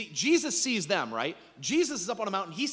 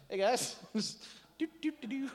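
light, offhand greeting, then soft little humming; mock nonchalance with a laugh; casual passer-by; 5 semitones higher, 3 dB quieter than usual. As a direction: breeze past with a playful do-do-do. Jesus acted strolling casually past boat.

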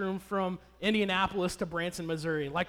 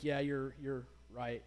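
softer, lower grudging admission; under my breath; 5 semitones lower, 10 dB quieter, slower than usual. Retold or imitated. Husband quietly conceding to wife under his breath. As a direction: mumble it, conceding reluctantly. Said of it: "I kinda said that under my breath".

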